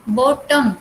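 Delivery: बॉटम with the vowel of the second syllable left out; second vowel dropped. Shown as a demonstration. Teacher giving an example of syllabic consonants, बॉटम with its second vowel unpronounced. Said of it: "वो pronounce नहीं हुआ है".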